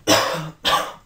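sudden cough fit. Real, not acted. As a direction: cough into the mic. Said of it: "Boah, sorry".

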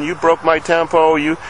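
mild, high-pitched mock scream of rage; imitated tantrum. Retelling a boy's fit over his smashed Tempo, mock enraged. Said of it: "screaming in rage".

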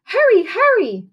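put-on a frightened girl screaming for help; panicked scream. A narrator's reading. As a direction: shriek it down the phone in a panic. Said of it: "she shrieked".

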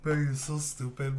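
ordinary talk

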